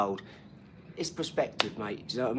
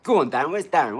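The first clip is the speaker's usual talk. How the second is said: much louder goading shout; loud calling out; 5 semitones higher, 12 dB louder than usual. Real, not acted. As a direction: call him out across the room. Calling out troll loudly.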